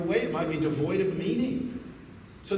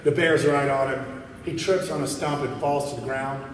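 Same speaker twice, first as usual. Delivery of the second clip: normal talking voice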